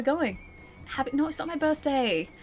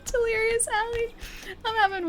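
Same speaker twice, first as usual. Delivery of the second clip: helpless giggling, near tears; can't stop laughing; 11 semitones higher than usual. Real, not acted. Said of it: "I can't stop laughing".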